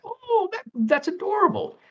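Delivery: high-pitched adoring squeal of delight; gushing coo. Acted. Reacting to a cute cartoon bunny character, high gushing coo.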